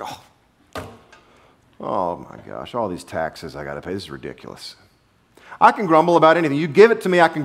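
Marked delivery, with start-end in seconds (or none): 0.0-4.8 mock complaining. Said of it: "I'm like".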